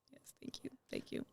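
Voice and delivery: breathless whisper, overwhelmed; whispered thanks. Retold or imitated. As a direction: whisper it, barely able to speak. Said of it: "I was like".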